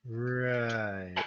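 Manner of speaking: ordinary talk, nothing marked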